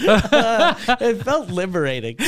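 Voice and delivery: laughing confession. Real, not acted. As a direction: say it while cracking up. Laughing about the incident.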